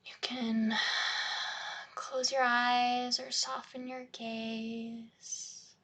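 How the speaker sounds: slow meditation voice with long pauses; guided meditation. Shown as a demonstration. Therapist guiding a client to breathe and feel, guided meditation.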